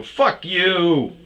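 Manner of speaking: high, loud curse; retold parting shout; retold yell. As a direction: yell it as the door shuts. Slamming door, yelling.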